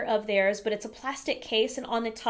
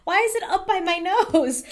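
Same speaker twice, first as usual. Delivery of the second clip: laughing; 7 semitones higher, 6 dB louder than usual. Retold or imitated. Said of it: "I'm like".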